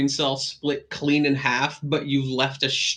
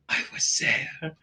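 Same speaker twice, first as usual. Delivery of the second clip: dramatic; 5 dB quieter, slower than usual. Acted. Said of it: "very dramatically and theatrically".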